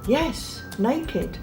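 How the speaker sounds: plain reading voice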